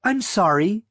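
high, polite operator voice; switchboard girl. A narrator's reading. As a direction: apologize as a switchboard operator, voice lifted. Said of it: "the switchboard girl said".

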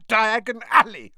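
loud shout through coughing; choked shout. A narrator's reading. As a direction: call it out while choking. Choking on ash while calling.

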